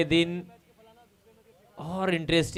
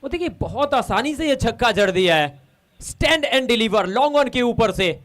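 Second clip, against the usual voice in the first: excited cricket commentary; 4 semitones higher, 5 dB louder than usual. Real, not acted. Co-commentator reacts to six.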